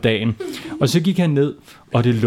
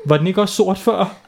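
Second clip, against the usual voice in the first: higher, mock-naive doctor; lightly higher mock-innocent; 7 semitones higher than usual. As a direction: play dumb about the burnt shirt.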